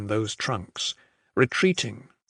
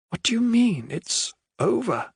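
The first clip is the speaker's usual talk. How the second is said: high, strained demand that cracks; demanding, voice cracking; 11 semitones higher than usual. A narrator's reading. Adair confronting the general over the battle, voice cracking. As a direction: voice breaking as she demands. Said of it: "she demanded, voice cracking".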